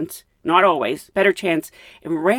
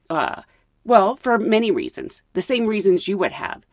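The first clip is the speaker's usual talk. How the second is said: normal talking voice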